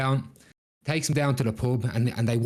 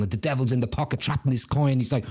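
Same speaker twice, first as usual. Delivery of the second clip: ordinary delivery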